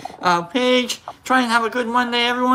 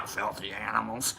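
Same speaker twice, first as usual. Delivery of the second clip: much lower and softer; low quoted growl-off; 11 semitones lower, 14 dB quieter than usual. Acted. Host repeats film line to viewers, low and quiet. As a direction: drop it right down, almost to yourself.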